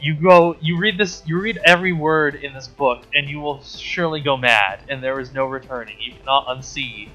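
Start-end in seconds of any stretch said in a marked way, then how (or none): none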